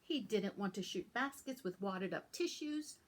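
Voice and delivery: usual speaking voice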